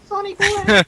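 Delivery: high, loud granny voice; old lady voice. Pretend. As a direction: cackle it like a nosy grandma. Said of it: "Why is [name] doing an old lady voice".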